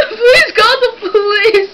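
faking a crisis, cracking up mid-plea; fake panic. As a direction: beg hysterically while barely holding back a laugh. Prank caller fakes an emergency.

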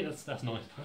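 ordinary talk, nothing marked

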